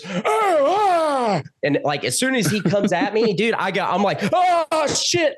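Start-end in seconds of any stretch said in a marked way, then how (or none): 0.0-1.4 high, drawn-out attack yell; long high-pitched imitation scream; Nicolas Cage. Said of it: "he goes, he goes". 4.3-5.4 high, drawn-out mock scream; panicked cry, imitated at high pitch; scared. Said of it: "I'm so scared that it".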